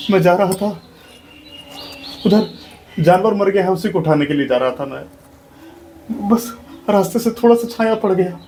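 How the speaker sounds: high, rising cries of don't beat me; desperate begging. Acted. Beaten man begs his master to stop hitting him, desperate high pleading. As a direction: plead for mercy as the blows come.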